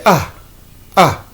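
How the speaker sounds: A as a short falling "uh"; short u sound. Shown as a demonstration. Teacher isolating the vowel for learners, short u. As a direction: say the sound of u in us, alone. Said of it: "mais le son, c'est".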